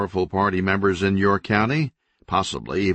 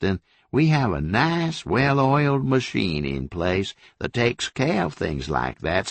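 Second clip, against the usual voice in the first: mocking sheriff; 7 semitones higher than usual. A narrator's reading. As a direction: coo at him like he's a little boy. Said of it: "The sheriff broke into a big laugh".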